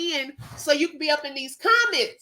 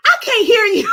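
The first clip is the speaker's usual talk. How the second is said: louder imitating mom on a bad call; mom on the phone; 6 semitones higher, 9 dB louder than usual. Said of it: "she'll be like".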